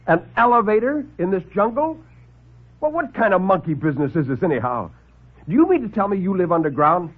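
high, mocking disbelief; incredulous scoffing. Lieutenant mocks the doctor's jungle elevator, incredulous. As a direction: scoff at the whole idea.

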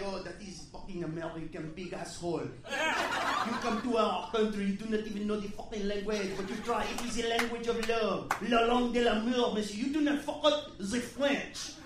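lightly voiced offended French waiter; a hint of a French lecture; French accent. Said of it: "and he's like".

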